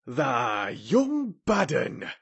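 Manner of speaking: slow, drawn-out Yorkshire rebuke; outraged. A narrator's reading. Ben calling down at Mary, outraged. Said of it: "He was so outraged".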